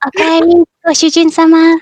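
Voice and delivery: sweet, high maid greeting; cute anime girl voice. Pretend. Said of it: "cute anime girl Oni-chan voice".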